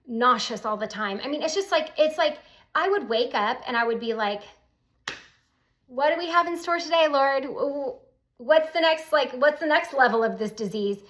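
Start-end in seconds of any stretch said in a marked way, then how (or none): 5.9-11.0 exasperated. Retold or imitated. Retold morning prayer of exasperation.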